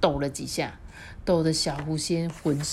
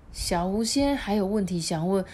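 ordinary delivery

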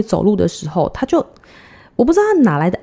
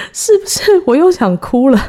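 high laughing words while holding back tears; 笑着说快哭了; laughing through tears; 7 semitones higher, 5 dB louder than usual. Real, not acted.

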